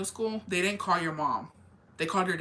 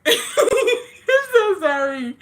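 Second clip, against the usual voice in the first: high, loud laughing apology; helpless laughter; 8 dB louder than usual. Real, not acted. Apologizing to viewers, breaking into real laughter. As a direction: crack up while saying sorry. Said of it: "That sounded so bad".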